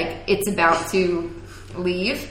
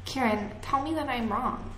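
put-on soft, higher Valley Girl voice; hushed attempt at a Valley Girl lilt; weird Valley Girl; 4 semitones higher, 9 dB quieter than usual. Shown as a demonstration. Retrying the impression for a co-host, quiet Valley Girl. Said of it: "just, like, weird Valley Girl".

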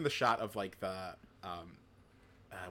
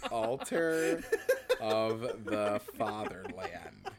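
laughing; 6 semitones higher, much slower than usual. Real, not acted. Laughing at the odd name.

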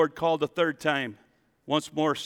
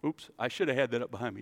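ordinary delivery

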